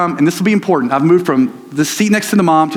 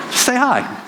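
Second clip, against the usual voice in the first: upbeat encouragement; 9 semitones higher, slower than usual. Shown as a demonstration.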